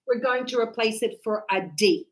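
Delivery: ordinary delivery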